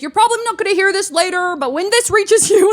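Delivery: high, loud put-on voice; loudmouth recording memo. Loud passenger recording voice memos, mock yapping. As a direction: mimic an oblivious man dictating a message.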